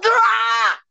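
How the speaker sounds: high, slow laughing wail; laughing cry. Real, not acted. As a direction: wail through helpless laughter. Breaks down laughing at failure.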